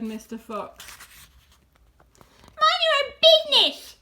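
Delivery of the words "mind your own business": very high, loud retort; indignant shriek. A narrator's reading. Rat snapping back at Fox, shrieking. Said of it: "shrieked Rat".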